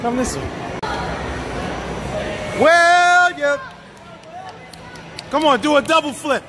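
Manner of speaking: ordinary talk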